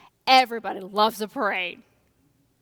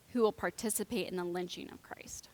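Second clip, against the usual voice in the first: whispered, softer; solemn whisper; 4 semitones lower, 10 dB quieter than usual. Real, not acted. Preacher tells congregation their guilt, whispered. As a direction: breathe it gravely. Grave point in sermon.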